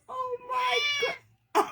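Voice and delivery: high squeal of delight. Real, not acted. Cat snuggles in.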